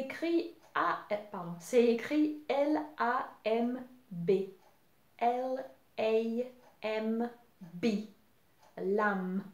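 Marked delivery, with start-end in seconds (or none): none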